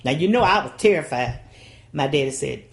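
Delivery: ordinary talk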